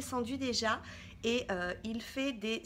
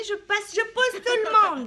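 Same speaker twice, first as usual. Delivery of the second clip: loud, high, pushy banter; boisterous entrance; 8 semitones higher, 11 dB louder than usual. Real, not acted. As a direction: barge in loudly, playfully. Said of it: "c'est une entrée fracassante". (entrée bruyante et enjouée)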